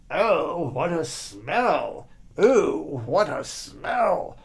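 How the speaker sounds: very slow, disgusted oh, what a smell; passer-by teaching the boy, slow and disgusted. Acted. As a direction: drag it out, nose wrinkled. Disgusted at stink.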